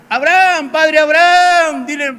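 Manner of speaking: high, drawn-out plea to Abraham; the rich man begging from the flames; pleading cry. Retold or imitated. Rich man in hell crying out to Abraham.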